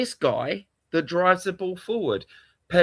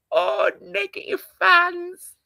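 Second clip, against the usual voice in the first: slow, high put-on voice; mock voice; 10 semitones higher than usual. Streamer parodying fans who call him negative, slow high mockery. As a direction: drag it out, mocking the accusers. Mocking fans' accusation.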